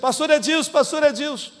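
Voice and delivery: calling out. Retold or imitated. Someone calls the departing pastor back, imitated shout. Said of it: "alguém me gritou assim".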